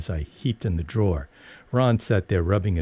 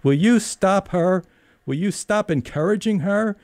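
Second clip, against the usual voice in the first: calling out; 12 semitones higher than usual. Retold or imitated. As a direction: call out to the husband in exasperation. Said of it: "called to Mister Potemkin".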